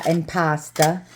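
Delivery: impasta with a New York twang; New York accent. Shown as a demonstration. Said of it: "I have a bad, uh, a bad New York accent".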